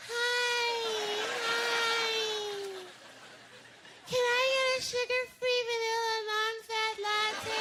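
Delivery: put-on high, sing-song valley girl order; teen girl voice. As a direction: chirp the order like a teenager. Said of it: "They talk like they're fifteen".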